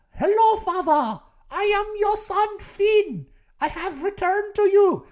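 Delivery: high, loud mock German impostor voice; fake German accent. Impostor posing as lost son, hammed-up German accent. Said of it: "this increasingly German accent you're talking with".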